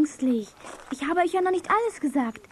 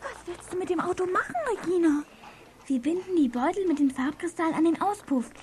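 normal talking voice

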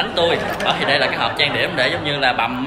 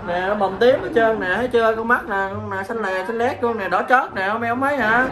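higher-pitched, put-on whining insistence; feigned upset; 6 semitones higher than usual. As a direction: plead the fake injury, pitch raised.

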